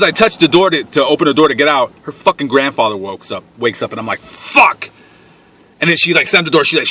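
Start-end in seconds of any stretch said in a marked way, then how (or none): 4.2-4.9 high, sudden burst of dismay; alarmed curse. Retold or imitated. Trapped as her grandfather wakes up, alarmed curse. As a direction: blurt it high, as if caught escaping.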